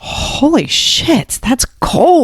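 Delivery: slow, high, stunned "holy shit"; shocked inner reaction. Retold or imitated. Recalling a boss's cold words, slow and high with shock. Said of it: "And I was like".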